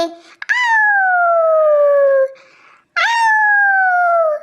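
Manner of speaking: high, loud drawn-out howl; fox howl. Acted. Fox showing camel its after-meal habit, howling loudly. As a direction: let out a long fox howl. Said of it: "चिल्लाने लगते हैं".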